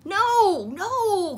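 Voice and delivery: high, loud protesting no; Froggy protesting to mother; cried out. A narrator's reading. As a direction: protest like a frog child. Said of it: "cried Froggy".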